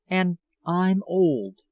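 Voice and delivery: slow, loud and bitter; slow bitter admission. A narrator's reading. Older woman confesses her age to lover, slow and bitter. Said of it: "bitter self-pity".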